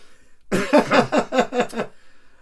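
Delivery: loud, high choking cough; coughing fit. Real, not acted. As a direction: cough violently. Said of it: "now I'm choking to death".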